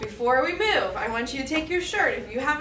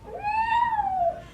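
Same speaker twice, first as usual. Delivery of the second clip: high, crying meow; cat meow. Acted. Yoga teacher voicing the cat for the kids, wailing. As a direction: wail like a startled cat. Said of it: "the cat scrunches up here and goes".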